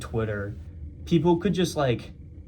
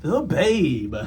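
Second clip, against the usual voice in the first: Babe Ruth voice; 4 semitones higher, 3 dB louder than usual. Pretend. Repeating the Babe sign-off.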